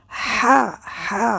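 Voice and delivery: "হা" pressed from a tightened throat; throaty ha. Shown as a demonstration. Teacher demonstrates the throaty "হা" to students. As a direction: squeeze the throat for a breathy "হা". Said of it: "কণ্ঠ নালী চিপা করে উচ্চারণ করতে হবে".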